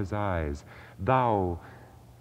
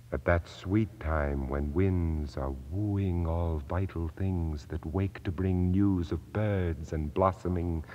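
plain reading voice

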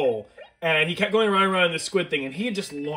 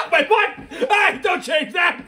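laughing yell; 14 semitones higher, 8 dB louder, slower than usual. Real, not acted.

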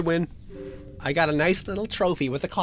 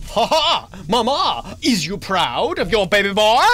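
gleeful crowing; 6 dB louder than usual. Real, not acted. Calling to mama after escaping, gleeful and loud.

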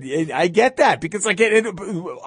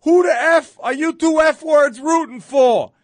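high, loud yelled challenge from a Philly fan; mock hostile shout; 12 semitones higher, 6 dB louder than usual. Angry fan shouting at Cowboys fans outside the stadium, loud and high. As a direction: bark it like a drunk heckler picking a fight. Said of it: "this guy walks up and goes".

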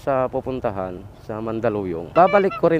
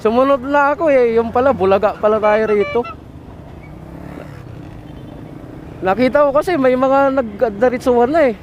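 agitated explaining; 10 semitones higher, 4 dB louder than usual. Real, not acted. Agitated retelling of the violation.

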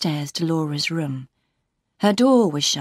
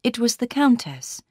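plain reading voice

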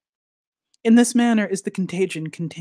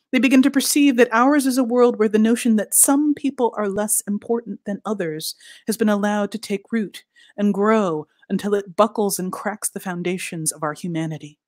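ordinary delivery

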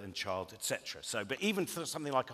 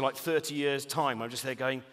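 usual speaking voice

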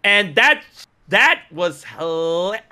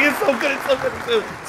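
giddy fan; 9 semitones higher than usual. Retold or imitated. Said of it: "I'm like".

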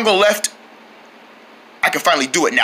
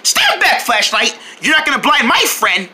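ordinary talk

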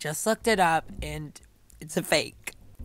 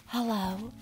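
small, soft, higher hello; mock normal villager; 5 semitones higher, 4 dB quieter than usual. Player imitates normal villagers' greeting, soft and higher. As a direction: imitate a meek little greeting. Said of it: "They're just like".

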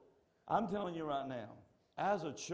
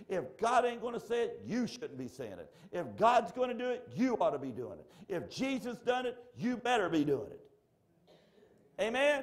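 preaching build; 7 semitones higher, 4 dB louder than usual. Real, not acted. Rising call-and-response build.

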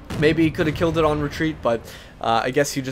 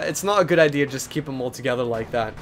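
ordinary delivery

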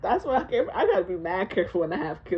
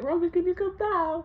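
dad pleading; 10 semitones higher than usual. Retold or imitated. Dad calling up to kid.

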